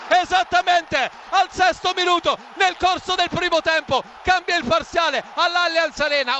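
excited replay call; breathless italian goal commentary. Real, not acted. Still excited after the goal. (telecronaca concitata dopo il gol)

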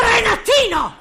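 very high shouted name; shrieked name. Acted. Mother cutting off her son's cheek, very high shout. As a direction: shout his name to cut him off.

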